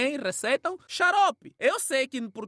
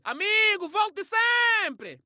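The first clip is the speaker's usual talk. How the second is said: cheerful host; 9 semitones higher, much slower than usual. Retold or imitated. Host calling cheerfully to departing visitor. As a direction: send the guest off warmly. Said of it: "o dono da casa, o que é que vai dizer?".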